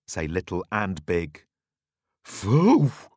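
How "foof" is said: loud puffing foof; dog bark. Acted. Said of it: "barks the dog".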